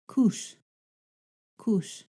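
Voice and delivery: "Coos" closing on an sh-like hiss; Yurok S. Shown as a demonstration. Teacher repeats the word for learners, Yurok S demo. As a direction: say "Coos" near "koosh", spaced out. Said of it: "you can hear it in the word coos".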